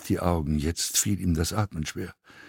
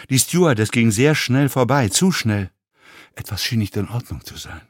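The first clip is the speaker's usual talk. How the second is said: usual speaking voice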